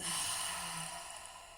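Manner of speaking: slow audible sigh out through the mouth; long exhaling sigh. Shown as a demonstration. Guide showing a release through the mouth, long sigh. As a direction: let all the air go in a sigh. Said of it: "releasing through the mouth".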